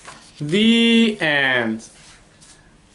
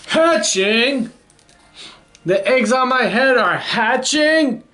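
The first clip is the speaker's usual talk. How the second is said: high, disbelieving questions into a long wail; horrified elephant; 9 semitones higher, slower than usual. A narrator's reading. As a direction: build from shocked question to drawn-out protest. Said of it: "says the elephant".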